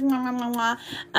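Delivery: soft smacking air kisses; quiet mwah mwah kisses; kiss sounds. Pretend. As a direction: blow soft kisses at the camera. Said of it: "Here, kisses".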